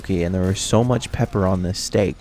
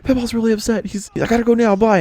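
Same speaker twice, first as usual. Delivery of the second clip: upset crying. Retold or imitated. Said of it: "she was, like, crying".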